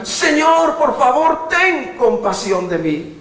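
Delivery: high, loud desperate plea to God; desperate cry. Pretend. Preacher showing a believer how to cry out, desperate loud plea. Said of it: "levantarte ... y declarar".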